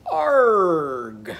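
loud, stretched "Arg" sliding downward; vowel swapped to "ar". Shown as a demonstration. Teacher leads children repeating egg, drawn-out "Arg". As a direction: hold the "ar" in "Arg" long and loud. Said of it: "Can you say that after me".

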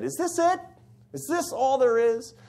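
retold disbelief, pitched very high; high-pitched mock dismay; incredulous questioning. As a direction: ask it as a high, exasperated quote. Retold moment of disillusioned questioning.

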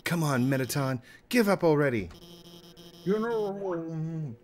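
high defiant heroine. Acted. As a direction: tell the villain off. Voices Alphys.

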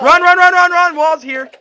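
urgent shouted run, run; panicked yelling while fleeing; being chased. Real, not acted. Wall closing in, fleeing.

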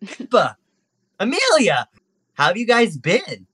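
high-pitched greeting, name by name; chummy little monkey. Acted. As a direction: hail old friends in a small monkey voice. GM voices monkey Pete.

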